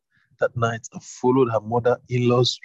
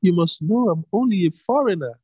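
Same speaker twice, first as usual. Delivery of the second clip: put-on lightly higher, humble young woman; a hint of a shy young woman; Ruth's voice; 8 semitones higher, 3 dB louder than usual. A narrator's reading. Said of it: "she asked".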